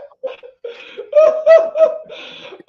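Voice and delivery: high, loud peals of laughter; real hearty laugh. Real, not acted. Host hearing the singer admit he yells, cracking up. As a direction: crack up helplessly.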